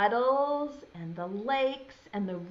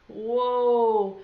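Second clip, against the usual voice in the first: mock amazed whoa; 5 dB louder than usual. Showing the rain cloud picture.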